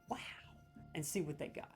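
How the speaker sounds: usual speaking voice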